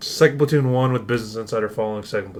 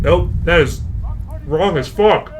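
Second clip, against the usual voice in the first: indignant outburst; 5 semitones higher, 4 dB louder, slower than usual. Real, not acted. Reacting to the wrong answer.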